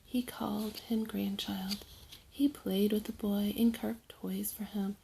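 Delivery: ordinary delivery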